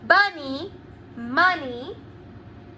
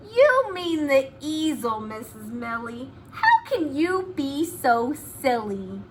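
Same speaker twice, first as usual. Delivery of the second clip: ordinary talk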